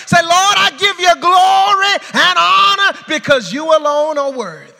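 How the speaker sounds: loud, high shouted preaching; full-force preaching. Real, not acted. Pastor exhorting the congregation to pray honestly, shouting.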